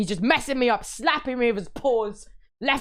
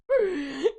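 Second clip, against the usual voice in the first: mock gasp; 9 semitones higher than usual. Shock at not streaming.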